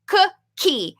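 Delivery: Cookie, put the k in the middle clearly; emphasized model word. Shown as a demonstration. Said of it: "say it slowly and a little bit more loudly".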